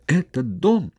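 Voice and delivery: high, bitter mocking echo; understated bitterness; bitter scoff. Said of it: "Он горько усмехнулся".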